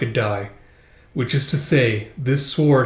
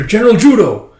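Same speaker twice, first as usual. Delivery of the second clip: loud high call across camp; 9 semitones higher, 9 dB louder than usual. Acted. Soldier hailing the general across camp, loud call. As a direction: shout the name to get attention.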